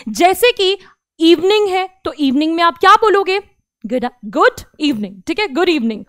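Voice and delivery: ordinary delivery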